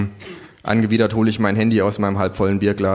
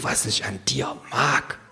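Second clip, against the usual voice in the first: sly kangaroo; 2 semitones higher, 6 dB quieter than usual. A narrator's reading. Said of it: "fragt das Känguru".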